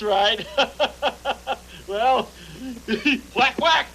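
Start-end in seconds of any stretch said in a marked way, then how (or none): 3.4-3.9 playful whack whack. Acted. Playful noise.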